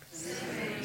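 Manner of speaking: breathy whispered echo; hushed repeat of the phrase. Shown as a demonstration. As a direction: whisper it back to the room. Whispered echo after call.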